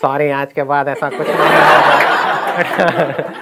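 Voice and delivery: very slow, odd put-on apology voice; funny apologetic voice. The PT sir apologising to Sandeep, in a funny slow voice. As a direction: drag out the apology in a comic voice. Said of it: "उनकी आवाज़ थी बड़ी funny सी".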